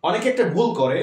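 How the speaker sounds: ordinary talk, nothing marked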